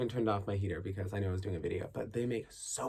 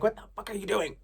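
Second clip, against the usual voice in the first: mock yell; 12 semitones higher, 5 dB louder, faster than usual. Repeated yell at neighbors.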